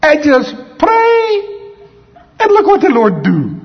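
a terrible Puerto Rican imitation, drawn out on "pray"; Puerto Rican accent. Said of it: "I'm trying to speak in Puerto Rican accent".

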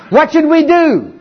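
high, slow, fretful plea; panicky Israelites. Acted. Israelites afraid of the flooded Jordan, high and panicky. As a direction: beg for help in a mock panic.

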